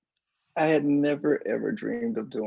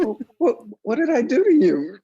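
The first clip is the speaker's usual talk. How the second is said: high, loud bewildered protest; taken aback; 11 semitones higher, 8 dB louder than usual. Retold or imitated. Answering a woman who said she hated them, taken aback. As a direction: re-enact startled protest, pitched way up.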